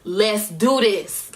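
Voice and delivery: slow, drawn-out determination; firm pump-up. Retold or imitated. Singer psyching herself up before the show, determined. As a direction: stretch out each word with resolve. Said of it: "that type of vibe".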